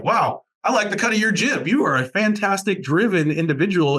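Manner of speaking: admiring, high-pitched quote of a recruiter; impressed recruiter. Retold or imitated. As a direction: imitate a recruiter wowed by a student. Voices impressed recruiters reacting to students.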